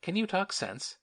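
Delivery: high, unsteady plea; faltering. A narrator's reading. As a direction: voice wavering with nerves. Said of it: "faltered the schoolmistress".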